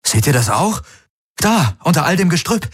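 high, urgent pointing-out; excited exclamation. A narrator's reading. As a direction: call their attention to a sudden find. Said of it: "rief er aus".

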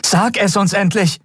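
high, desperate demand. A narrator's reading. Woman demanding the captive finally talk, desperate. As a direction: push it out at the end of your rope. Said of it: "Sie hörte die Verzweiflung ihrer eigenen Stimme".

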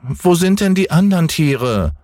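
sehr hohe Kinderstimme, fragend; neugieriger Häwelmann. A narrator's reading. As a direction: ein Junge ruft neugierig hinauf. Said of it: "rief der kleine Häwelmann hinauf". (hohe, neugierige Kinderfrage)

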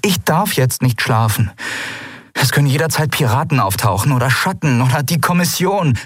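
high-pitched, worked-up female protest; protesting woman. A narrator's reading. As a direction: pile up the reasons urgently, pitched high. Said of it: "protestierte sie".

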